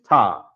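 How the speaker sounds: "Ta" as one open, falling syllable; syllable demonstration. Shown as a demonstration. Teacher showing students the first syllable of Tana'a, "Ta" sounded out alone. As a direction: set "Ta" apart and sound it out. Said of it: "the first syllable of".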